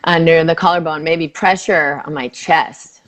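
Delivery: soothing tapping script; guided tapping. Acted. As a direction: lead the phrase gently for her to repeat.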